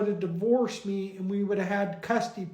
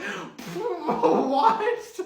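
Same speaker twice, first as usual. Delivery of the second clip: shocked; 6 semitones higher than usual. Acted. Mommy shocked by the reveal.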